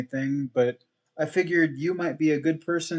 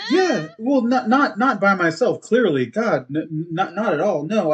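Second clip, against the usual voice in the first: louder, hurried self-correction; young adventurer admits he had help, flustered; flustered backpedal; 4 semitones higher, 5 dB louder than usual. Acted. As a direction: scramble to take it back.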